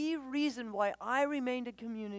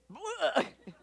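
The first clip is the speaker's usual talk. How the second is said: a quick bright laugh; short laugh; 6 semitones higher, 3 dB louder than usual. Real, not acted. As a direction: laugh at the puke story. Laughing at own story.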